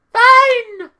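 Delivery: very high, loud squawked shout; exaggerated shout. Real, not acted. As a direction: yell it out at the top of your pitch. Climax of exaggerated concession.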